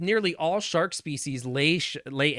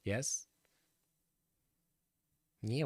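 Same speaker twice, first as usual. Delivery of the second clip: ordinary talk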